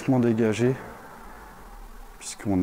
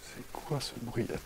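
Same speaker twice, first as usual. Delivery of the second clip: hushed; 12 dB quieter than usual. Real, not acted. Exploring creepy house, strange noise.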